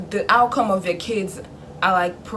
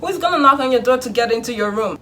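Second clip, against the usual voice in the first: usual speaking voice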